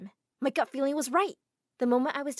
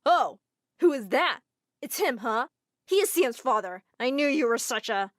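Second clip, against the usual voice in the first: louder, accusing tone; jealous outburst; 7 dB louder than usual. Acted. Groom accusing Hanny about her ex, louder and angry. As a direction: accuse her with rising anger.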